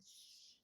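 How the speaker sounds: soft unvoiced shush. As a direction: hush them like a shared secret. Hushing a secret to customer.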